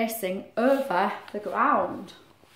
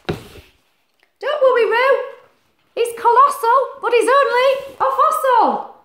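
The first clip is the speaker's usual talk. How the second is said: high, louder, slow comforting voice; reassuring character; 12 semitones higher, 7 dB louder, slower than usual. Acted. Animal reassuring scared Roo, high and slow. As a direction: soothe the frightened joey.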